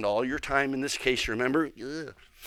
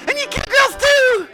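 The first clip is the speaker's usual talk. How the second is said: much higher, loud shouted interjection; puppet shout; 10 dB louder than usual. Pretend. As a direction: cut through the singing with a squeaky shout. Puppet butts into the hymn.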